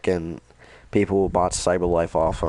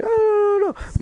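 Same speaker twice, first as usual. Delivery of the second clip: squeaky mock dismay; 5 dB louder than usual.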